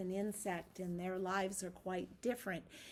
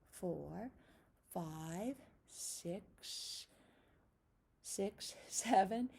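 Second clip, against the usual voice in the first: ordinary talk, nothing marked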